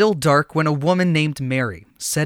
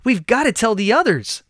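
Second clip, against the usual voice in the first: higher, eager exclamation; exclaimed; 6 semitones higher than usual. A narrator's reading. Women deciding to run to the disciples, eager. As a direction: urge each other on. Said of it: "they exclaimed in unison".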